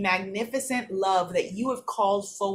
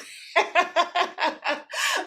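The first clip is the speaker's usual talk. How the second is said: high laugh; 9 semitones higher than usual. Real, not acted. Laughing at her own joke.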